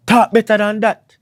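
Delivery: loud, high-pitched indignant rebuke; mock scolding shout. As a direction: shout it like an outraged parent correcting speech. Acted rebuke from Jamaicans at home.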